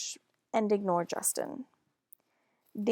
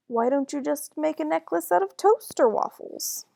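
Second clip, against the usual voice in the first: higher, louder mocking boy voice; loud taunting kid voice; teasing boy; 6 semitones higher, 6 dB louder than usual. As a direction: tease her like a smug little boy. Justin teasing Amber, voiced.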